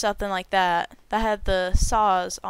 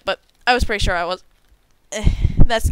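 ordinary talk, nothing marked

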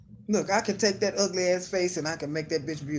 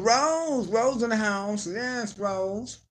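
excited greeting; 7 semitones higher, much slower than usual. Real, not acted. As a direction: greet her brightly by name. Greeting a viewer by name.

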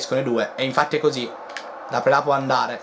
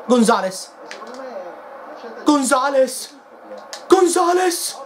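name called higher and higher each time; rising excited call; 9 semitones higher, slower than usual. Real, not acted. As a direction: build the shout on every repeat. Crescendo call on a counterattack. (chiamata sempre più esaltata)

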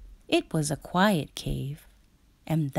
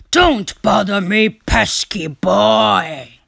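bellowing; 4 semitones higher, 8 dB louder than usual. A narrator's reading. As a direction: boom it out to scare the boy off. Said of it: "he bellowed".

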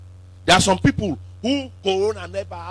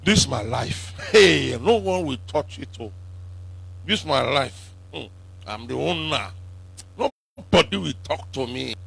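lower, softer voice of an anxious church member; worried believer; 7 semitones lower, 5 dB quieter than usual. Retold or imitated. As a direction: mimic the fretful doubter clinging to his life. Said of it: "some of you now want for".

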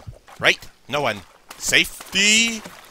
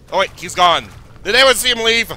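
ordinary talk

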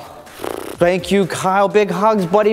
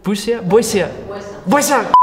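usual speaking voice

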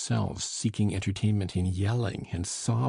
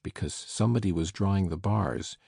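usual speaking voice

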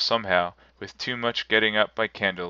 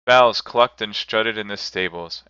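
ordinary delivery, nothing marked

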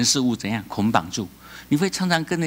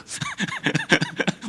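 hearty laugh; 3 dB quieter than usual. Real, not acted. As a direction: laugh out at your own joke. Laughing at his own joke.

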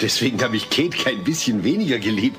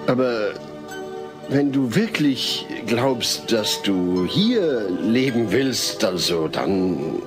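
slow, halting, sinking lower at the end; reluctant concession; 3 semitones lower, much slower than usual. Acted. Father lets houseguest stay, reluctantly. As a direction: give in haltingly, drawing the words out.

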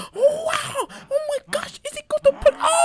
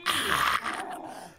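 ordinary talk

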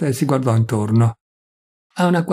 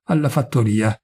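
usual speaking voice

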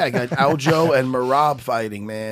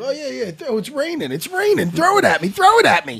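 high, eager, rising louder; excited; 10 semitones higher, 4 dB louder than usual. Real, not acted. Cohost excitedly asking for the sweetener to be thrown. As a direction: playfully beg for the bag. Splenda bag tossed over, excited.

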